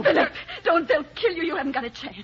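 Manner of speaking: high, urgent begging; frightened plea. Acted. Begging him not to fight outlaws.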